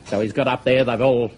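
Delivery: ordinary talk, nothing marked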